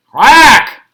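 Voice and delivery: loud quacked reply; loud duck quack. A narrator's reading. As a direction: quack back loudly like a tired duck. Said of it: "The duck answered".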